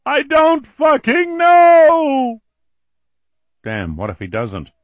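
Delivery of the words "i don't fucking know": high, loud slow scream. Acted. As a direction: scream it in terror. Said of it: "Billy was screaming".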